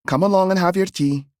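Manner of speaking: high, quick, brisk woman; brisk aunt. A narrator's reading. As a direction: hurry the child along. Aunt dismisses.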